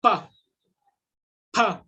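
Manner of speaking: pa with both lips pressed together, then released; Mandarin pa. Shown as a demonstration. Teacher demonstrating pa for online Mandarin students. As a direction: say pa with lips closed first then burst open. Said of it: "put them together and then say".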